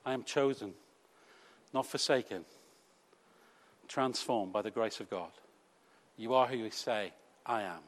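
a hushed confession of faith, spoken gently; soft, hushed affirmation. Pretend. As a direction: murmur it as a quiet, reverent prayer. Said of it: "You hear the whisper of the Lord".

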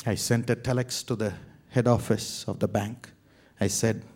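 ordinary talk

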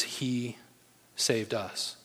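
"he" set apart by a pause before "saved us"; stress on he. Real, not acted. Contrast with saving ourselves.

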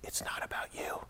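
soft, tender whispered reminder; loving whisper. Retold or imitated. Wife lovingly reminds Josh, soft whisper. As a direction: breathe it gently, like a wife calming her husband. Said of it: "She, very lovingly, she said".

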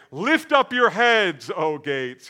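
loud slow call to the gates; crying out. Acted. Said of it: "they cry out to the gates".

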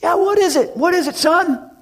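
high, loud call back across the lot; answering a faraway kid; pastor calling back. Retold or imitated. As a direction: shout back to the boy. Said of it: "pastor's looking and goes".

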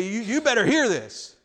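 lightly hammed-up bully prophet; pushy insistence. Imitating a prophet forcing his word on someone, mock pushy. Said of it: "wrestle them to the ground and say".

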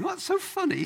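mock gleeful listener; amused squeal. Congregation member finding the scene funny, amused squeal. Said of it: "suddenly saying".

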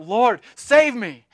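loud, slow, desperate cry; cry for help. Acted. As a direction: call out as if sinking in the water. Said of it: "he cried out".

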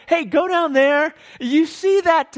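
high, loud mock instruction; calling out orders. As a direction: call out an errand to someone. Voicing someone giving orders.

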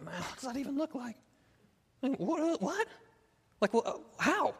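high-pitched baffled questioning; mock helpless confusion, high and searching; bewildered. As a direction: act lost, voice pitched right up. Acts his bewildered younger self.

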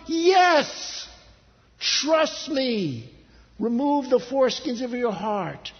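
loud, high preacher's command; emphatic, ringing reply; forceful insistence. Acted. God's answer at the climax.

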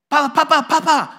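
put-on high, loud child calling papa; toddler calling papa. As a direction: cry out papa like a two-year-old. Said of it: "He goes".

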